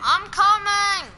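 slow, loud rallying call. Real, not acted. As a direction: shout that help is on the way. Teammate rushing to help.

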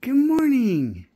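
high, slow sing-song greeting; talking to a dog. Real, not acted. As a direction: stretch it out sweetly to the dog. Stretched high morning greeting.

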